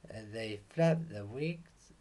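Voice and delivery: ordinary delivery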